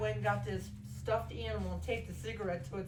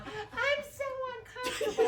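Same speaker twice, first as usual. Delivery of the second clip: mock whine; 13 semitones higher than usual. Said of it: "voicing friend cramped in car".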